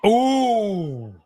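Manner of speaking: high wincing "ooh". Real, not acted. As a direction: react to a brutal hit. Reaction to hit.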